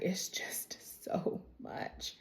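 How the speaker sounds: slow, hushed retelling of someone overwhelmed; overwhelmed near-whisper. Retold or imitated. Confiding in her sister about the rumors, overwhelmed whisper. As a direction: let it trail into a drawn-out whisper, imitating her.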